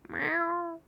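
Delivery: high cat meow, mewed softly. Shown as a demonstration. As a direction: mew like a cat. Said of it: "I actually have a really good meow".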